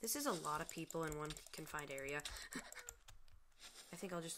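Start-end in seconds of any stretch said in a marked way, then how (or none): 2.3-3.9 giggle. Real, not acted. Crowded game area.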